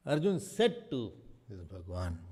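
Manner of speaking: ordinary talk, nothing marked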